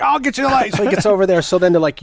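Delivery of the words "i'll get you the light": high, overeager helpful voice; eager nervous offer. Retold or imitated. Balsam rushing to the stove before cops, high and eager. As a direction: jump in too fast to help. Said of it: "Martin Balsam's like".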